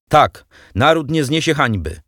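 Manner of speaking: the delegate shouts back at Lenin. A narrator's reading. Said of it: "krzyknął delegat". (wyższy, głośniejszy okrzyk)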